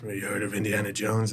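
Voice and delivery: lightly voiced low-key film star; Harrison Ford. Film star telling a caller his job, understated. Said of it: "He goes".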